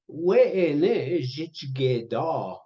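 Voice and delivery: stretched out, every syllable clear; very slow "We'ene jitchigeda"; slow teaching pronunciation. Shown as a demonstration. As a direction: model it slowly for learners. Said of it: "teaching word".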